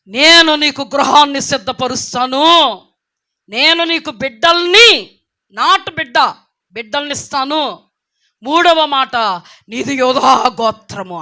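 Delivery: loud, slow proclamation; voice of God. Retold or imitated. Speaker relaying God's promise to her, loud solemn proclamation. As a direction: pronounce each promise like a divine decree. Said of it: "ప్రభువు నాతో మాట్లాడుతున్న మాట".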